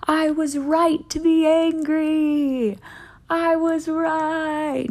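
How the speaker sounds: drawn-out mock outburst of righteousness; mock scream. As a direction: pretend to yell it at the whole world. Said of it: "and screaming".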